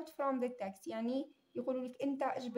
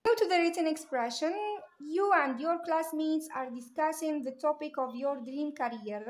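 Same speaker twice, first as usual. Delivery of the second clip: slow task reading; 3 semitones higher, 4 dB louder, much slower than usual. Shown as a demonstration. Teacher reading the exam instructions to students, slow and louder. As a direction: read "Go to the written expression" louder and slow. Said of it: "teacher reads exam task aloud".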